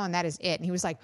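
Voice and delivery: ordinary talk, nothing marked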